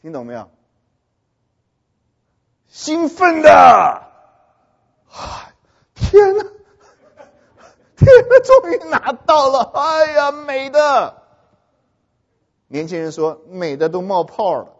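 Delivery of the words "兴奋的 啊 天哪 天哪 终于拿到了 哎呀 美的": high, loud hammed-up cry of joy; imitating an ecstatic crying graduate; mock tearful excitement. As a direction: wail with overjoyed relief, exaggerated. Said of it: "每一个大男人都像女孩子一样哭哦".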